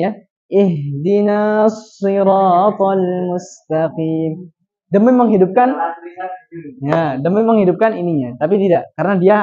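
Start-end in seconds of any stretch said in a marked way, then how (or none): 0.5-4.5 "Ihdinas" dipanjangkan, sangat lambat; contoh bacaan salah. Shown as a demonstration. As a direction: tiru bacaan yang keliru. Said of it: "jangan disangka bacanya seperti ini". (contoh salah yang diperlambat)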